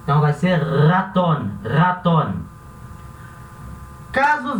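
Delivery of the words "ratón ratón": ratón com R forte e vibrante no início; rolled R. Shown as a demonstration. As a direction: vibre o R de ratón com força. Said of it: "a garganta vai vibrar". (R vibrante em ratón)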